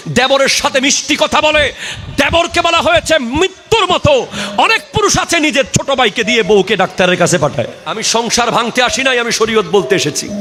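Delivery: high-pitched fiery sermon delivery; impassioned hellfire preaching; full-force preaching. Real, not acted. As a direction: warn the congregation with rising, ringing intensity.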